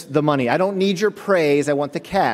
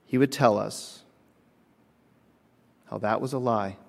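quiet, earnest sermon delivery; 4 semitones lower, 3 dB quieter than usual. Acted. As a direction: bring it home to the congregation. Preaching the sermon point.

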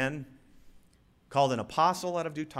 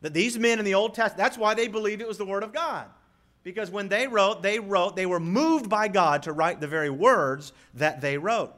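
loud, raised preacher's voice; emphatic preaching; 6 semitones higher, 6 dB louder than usual. Real, not acted. Preacher explains inspiration to the congregation, emphatic preaching. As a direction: drive the point home from the pulpit.

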